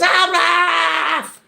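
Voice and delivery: high shouted Welsh word Araf; imitating a shouting Welsh road sign; mock sign shout. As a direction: bark it like a scolding sign. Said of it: "the next thing it goes".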